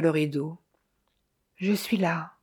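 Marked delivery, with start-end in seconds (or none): 1.6-2.3 faint voice as if from very far away; fragile, distant voice. A narrator's reading. Clairvoyant answers from behind the curtain, fragile and distant. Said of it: "Une voix infiniment fragile, semblant venir de très loin".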